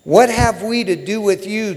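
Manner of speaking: acted outcry of a demon; crying out. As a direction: cry out a loud plea to be left alone, as in a Bible reading. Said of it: "he cried out".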